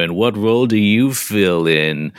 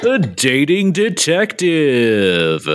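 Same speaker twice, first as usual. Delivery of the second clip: slow, showy game show welcome; flirty teasing dating host; 5 semitones higher, slower than usual. Acted. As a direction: draw out the big show intro.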